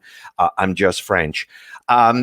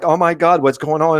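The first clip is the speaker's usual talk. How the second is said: mock-panicked outburst, higher pitched; retold panic; 6 semitones higher, 4 dB louder than usual. Speaker recalls hearing of shutdowns, retold panic. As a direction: act out mild alarm at the news. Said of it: "it was big panic there".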